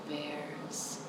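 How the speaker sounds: plain reading voice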